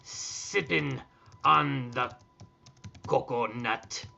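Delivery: slow pirate dictation, word by word; mock pirate with long growly "yar". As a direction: draw it out like a hammy pirate composing a letter. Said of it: "we should write this in pirate voice".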